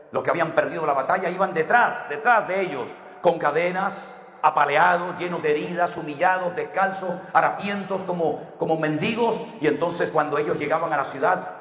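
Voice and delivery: rising, swelling preacher's cry; impassioned sermon delivery; preaching at full force. Acted. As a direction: paint the victory parade with mounting fervor. Preacher lists the captives' misery.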